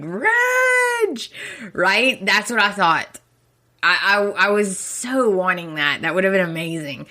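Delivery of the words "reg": hammed-up desperate yell at the door; mock frantic yell. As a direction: yell it through the glass doors. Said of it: "smack those glass doors and be like".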